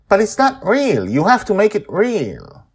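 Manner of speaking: put-on high, loud demanding little girl; insistent child. A narrator's reading. Joy telling Akanyang the dragon isn't real, insistent child. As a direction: insist like a stubborn kid.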